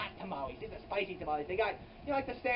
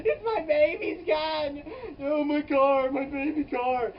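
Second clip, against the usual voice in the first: mock wailing; 7 semitones higher, 7 dB louder, slower than usual. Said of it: "And he's like".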